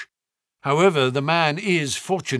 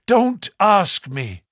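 Don't drawn out slowly, higher and louder; pleading; 7 semitones higher, 3 dB louder, slower than usual. A narrator's reading. Butler pleads with Sir Henry not to ask, slow and urgent. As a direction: beg him, stretching Don't ask me. Butler begs his master.

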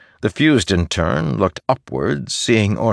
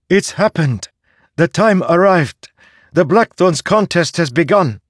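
high, urgent announcement; excited woman's voice; 11 semitones higher, 4 dB louder than usual. Acted. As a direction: break the news breathlessly.

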